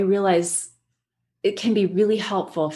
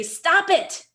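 higher, sharp mock scold; snapped stop it; 10 semitones higher than usual. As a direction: snap at the child in frustration. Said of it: "and you say".

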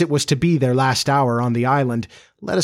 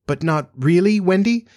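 higher-pitched, mildly worried question; anxious; 6 semitones higher than usual. A narrator's reading. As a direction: plead for reassurance, lifting at the end. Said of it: "he asked anxiously".

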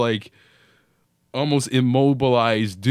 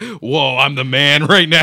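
cocky elation; 2 semitones higher, 6 dB louder than usual. Retold or imitated. Reenacting the morphine high.

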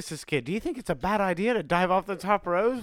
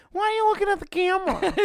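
put-on high, fast squeaky kid voice; high squeaky quote; 13 semitones higher, faster than usual. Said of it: "I guess, of the".

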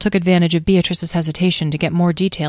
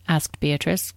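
plain reading voice

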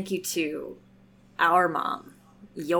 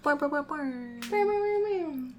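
playful high bouncing boings; boing sounds; 9 semitones higher, slower than usual. Pretend. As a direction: bounce it like a cartoon spring. Cheering patrons with bounce sounds.

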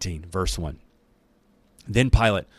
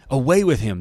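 louder, higher crowd yell; 5 semitones higher, 6 dB louder than usual. A narrator's reading. Crowd demanding Jesus' death, yelling loud. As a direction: bark it like an angry mob. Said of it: "they yelled".

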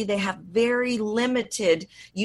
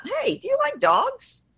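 high pitch with "dogs" swooping up; upward lilt. Shown as a demonstration. Voice coach demonstrating a questioning lilt that rises sharply on "dogs". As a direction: pitch the whole question much higher and lift "dogs" at the end. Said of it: "my voice goes up".

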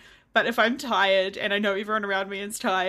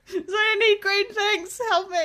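high, pathetic begging; mock plea; 11 semitones higher, 3 dB louder than usual. Exhausted person begging for greens, high pathetic plea. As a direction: beg like a wilting plant.

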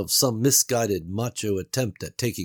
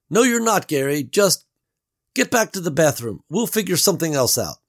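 higher, louder doubtful Beverly; Beverly's voice; 8 semitones higher, 6 dB louder than usual. A narrator's reading. Beverly doubts Gary's escape plan, worried and raised. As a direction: voice her worry, raised.

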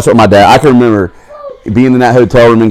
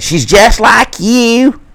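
high, slow put-on wife voice; mock wife; 13 semitones higher, much slower than usual. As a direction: sing it out like the teasing wife.

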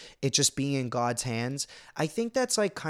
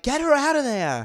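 high, loud mock yell of a panicked bystander; retold scream; 11 semitones higher, 6 dB louder than usual. A bystander yelling to get her out, mock yell. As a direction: imitate someone yelling to pull her out. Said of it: "someone screamed".

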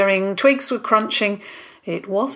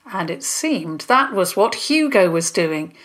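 plain reading voice

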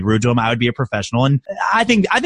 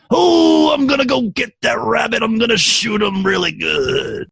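high, hammed-up cartoon cowboy voice; Yosemite Sam impression; 7 semitones higher than usual. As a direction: play the trigger-happy cartoon cowboy. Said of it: "it's a cartoon character that has made a comeback".

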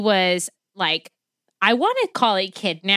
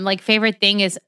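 ordinary delivery

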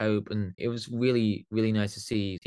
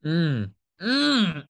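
mouth full; 7 semitones higher, 6 dB louder than usual. Real, not acted. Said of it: "Sorry, as I just, drinking my Diet Coke".

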